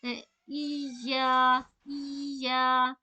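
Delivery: ई and या each drawn out evenly and very slowly; stretched vowels. Shown as a demonstration. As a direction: hold both vowels equally long. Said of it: "तो दो बार खींचेंगे".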